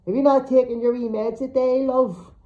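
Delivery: high, loud, talking down to her; patronising loud question; loud and slow. A narrator's reading. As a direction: speak up to a batty old woman. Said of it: "loudly and slowly".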